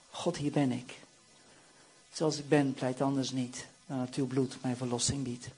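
low, soft, humble plea; hushed prayer of surrender. Acted. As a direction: pray quietly, handing yourself over. Voicing a surrendered prayer to God.

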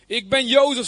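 loud reveal. Acted. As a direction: announce yourself to the brothers.

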